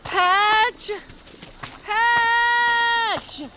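loud, high calls across the road; shouting a name. Acted. Noah searching for Hatch outdoors, shouting. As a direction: shout for a lost friend.

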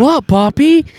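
put-on high, loud puzzled kid; innocent kid voice. Young boy answering his grandfather, innocent mock voice. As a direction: imitate a clueless boy. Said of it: "And Colton goes".

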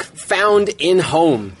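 drawn out word by word; slow, deliberate headline read. Shown as a demonstration. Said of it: "reading the headline slowly".

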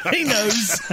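ordinary talk, nothing marked

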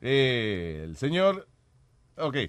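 usual speaking voice

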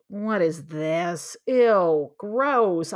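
drawn-out disbelief, then loud revulsion; mock disgust. Reacting to first hearing of self-compassion, disgusted. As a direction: recoil from a yucky idea, slowly.

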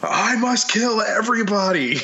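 menacing killer voice. Acted. Acting out an old-time killer.

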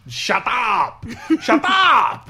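high, loud mock yell with a put-on accent; yelling shut up. Imitating Brad Pitt yelling at someone, loud and high. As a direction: bark it out like a bad German accent. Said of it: "he was just yelling at someone".

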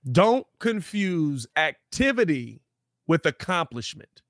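slow, deliberate restatement, word by word; emphatic repeat. Real, not acted. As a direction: stretch it out for the people in the back. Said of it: "one more time for the people in the back".

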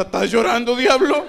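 loud, high mocking taunt; teasing gym crowd. Gym guys teasing the fallen boxer, loud mocking taunt. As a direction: tease him like the gym crowd. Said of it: "Está toda la raza".

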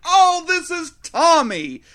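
high, slow and breezy, showing off the drawing; proud teacher showing off her student's art; unbothered teacher. Retold or imitated. Teacher casually explaining the nude drawing, high and slow. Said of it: "And she's like".

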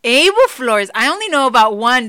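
loud, high-pitched call-out; mock outrage. Real, not acted. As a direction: burst out loudly, scolding him in disbelief. Shocked teasing scolding of partner.

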